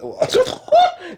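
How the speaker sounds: sudden squealing laugh; a shrill giggle escaping; high burst of laughter. Real, not acted.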